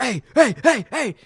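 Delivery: retold high, loud calls at a dog; alarmed shouting. Calling at an attacking dog.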